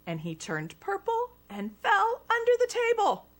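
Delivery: usual speaking voice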